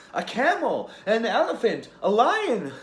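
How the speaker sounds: put-on a thrilled little girl naming animals; girl shrieking with joy at the animals; excited child. Child pointing at zoo animals.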